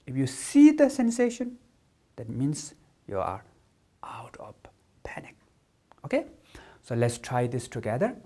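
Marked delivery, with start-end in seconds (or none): none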